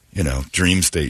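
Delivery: normal talking voice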